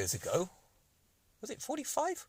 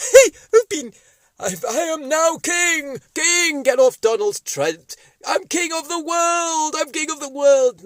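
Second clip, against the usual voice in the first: high, loud gloating puppet proclaiming; a puppet crowing that he's king; high loud triumphant cartoon ruler; Boris puppet voice; 11 semitones higher, 11 dB louder, slower than usual.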